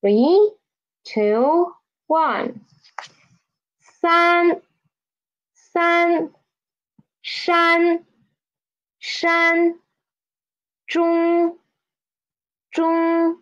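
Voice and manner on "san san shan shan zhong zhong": "San", "shan", "zhong" all held high and level. Shown as a demonstration. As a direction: read each syllable high and even, twice. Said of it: "let's try to read from the start".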